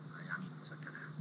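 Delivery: hushed, breathy aside to the congregation; whispered aside. Real, not acted. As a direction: lean in and whisper it. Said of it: "whispered aside". (bisikan kepada jemaat)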